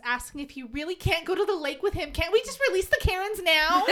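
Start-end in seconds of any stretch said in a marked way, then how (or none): 2.1-3.8 whiny. Retold or imitated. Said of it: "Sebastian whines".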